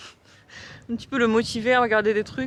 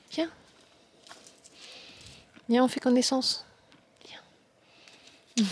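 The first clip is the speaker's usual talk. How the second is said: soft coaxing; 4 semitones higher, 5 dB quieter, slower than usual. Real, not acted. Coaxing a sheep.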